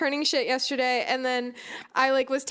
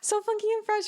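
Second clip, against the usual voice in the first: excited; 8 semitones higher than usual. Real, not acted. Excited praise of theme music.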